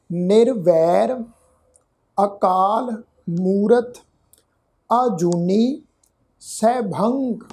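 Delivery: each word set apart, slow and deliberate; slow scripture recitation. Shown as a demonstration. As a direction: recite the prayer word by word with pauses. Recites Mool Mantar word by word.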